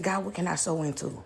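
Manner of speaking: hushed, low question to God; real quiet. Retold or imitated. Woman at peace asking God for direction, quiet. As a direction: drop to a very soft, low murmur. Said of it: "I just got real quiet".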